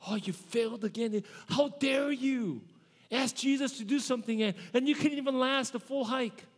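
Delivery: hammed-up stern church scolding. Shown as a demonstration. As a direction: nag Peter for failing again. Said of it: "maybe that's the tone that you've heard in".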